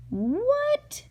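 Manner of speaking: cartoon gasp of disbelief; astonished Henry. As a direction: play the shocked selectman. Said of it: "said Henry B. Swap".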